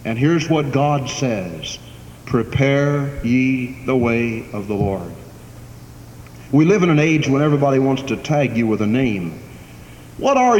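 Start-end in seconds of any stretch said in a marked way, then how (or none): 2.2-5.1 slow, drawn-out scripture line, each word weighed; slow solemn proclamation. Acted. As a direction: proclaim it slowly like the voice of God. Here's what God says.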